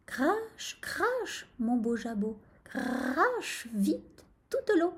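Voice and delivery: high little chant with drawn-out verbs; rooster's muttered spell. A narrator's reading. Rooster urges his crop to spit water, muttered chant. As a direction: chant it like a magic charm, unforced. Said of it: "se mit à marmotter".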